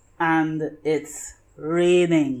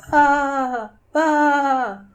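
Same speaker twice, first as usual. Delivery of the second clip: higher bleating sheep voice; 8 semitones higher, 4 dB louder than usual. Acted. Animals answer in the fog.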